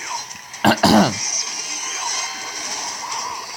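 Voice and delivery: clearing a sore throat; rough throat clear. Real, not acted. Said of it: "I'm losing my voice".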